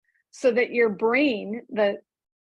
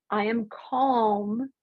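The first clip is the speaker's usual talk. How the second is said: slow, even "I am calm"; slow calm affirmation; much slower than usual. Shown as a demonstration. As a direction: settle into each word. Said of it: "you think".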